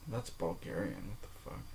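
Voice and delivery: muttered whisper to himself. Real, not acted. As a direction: whisper it under your breath. Muttering to himself while checking.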